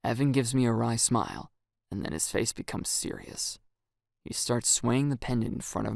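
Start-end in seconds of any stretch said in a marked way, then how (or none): none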